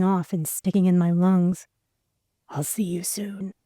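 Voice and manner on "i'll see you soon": soft unvoiced whispered promise. A narrator's reading. Glenn's last promise to Alana, whispered. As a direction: breathe the promise, barely audible. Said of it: "he whispered".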